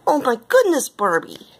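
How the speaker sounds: high-pitched "oh my goodness", dropping on the name; startled storybook cry; alarmed exclamation. A narrator's reading. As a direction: exclaim it with sudden realization. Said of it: "exclaimed Missus Appleberry".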